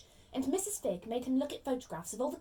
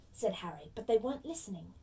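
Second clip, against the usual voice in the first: ordinary delivery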